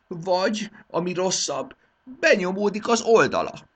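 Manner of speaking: high, loud whining royal lament; self-pitying king. Acted. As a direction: plead to be understood, aggrieved. King complains nobody understands.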